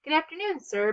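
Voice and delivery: bright called-out greeting, first word high; cheerful call. A narrator's reading. Ben calls out to the stern officer, cheerful and high. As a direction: greet him like an old friend. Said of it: "Ben called out cheerfully".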